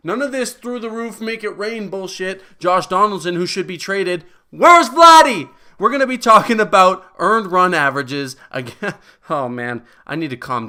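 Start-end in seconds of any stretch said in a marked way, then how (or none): 4.5-5.5 sudden high, loud call out; mock yell. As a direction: bellow the question like searching a crowd. Running gag, yelling for missing player.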